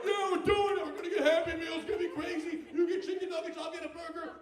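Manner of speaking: high, loud hyped-up child imitation; rally the gang like children at a party; excited kids. Kids celebrate McDonald's trip, high imitated frenzy.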